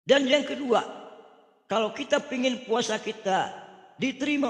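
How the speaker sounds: high, loud sermon delivery; emphatic preaching. Real, not acted.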